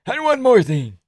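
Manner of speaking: high Georgian voice; Merab impression. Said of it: "Merab hijacks the post-fight speech".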